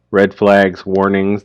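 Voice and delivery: usual speaking voice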